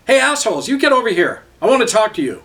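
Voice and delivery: high, loud angry shout; angry yell across the street. A narrator's reading. Frank yells at the cops beating a man, angry shout. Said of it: "he angrily yelled across the street".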